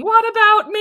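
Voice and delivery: high, loud wail; high, indignant mock scream; mock shriek. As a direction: shriek it like a hammed-up drama queen. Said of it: "still shrieking".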